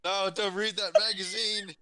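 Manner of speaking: high, loud protest; alarmed yell. Acted. Player yells at the one in his room, alarmed shout. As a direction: yell to stop a snoop. Yelling back at the snooper.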